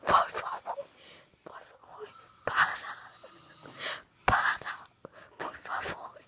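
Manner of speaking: urgent pleading, some words hissed hard; frightened pleading. Acted. Vlogger begging the unseen presence to stop, frightened stage whisper.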